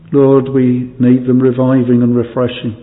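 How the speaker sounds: ordinary talk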